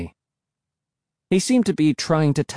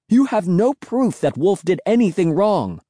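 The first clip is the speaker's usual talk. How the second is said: raised, high-pitched protest; shouting; 7 semitones higher than usual. A narrator's reading. Angrily defending Wolf to sister, raised shout. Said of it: "I shouted".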